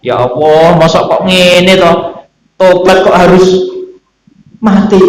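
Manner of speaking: imitated tearful plea. Prophet Musa crying to God over the repentance, mildly acted lament. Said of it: "Akhirnya Nabi Musa juga nangis".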